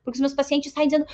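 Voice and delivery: usual speaking voice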